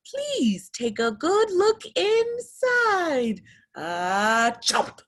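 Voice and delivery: high, slow, sly shark voice. Acted. Teacher plays the shark inviting little fish closer, slow and sly. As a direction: lure them in slowly, then chomp.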